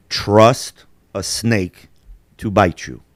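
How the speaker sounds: very slow, weighty quoted advice; solemn proverb. Retold or imitated. Older man advising a teenager about liars, slow and weighty. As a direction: space out each phrase like handed-down wisdom. Quoting an old Italian's proverb.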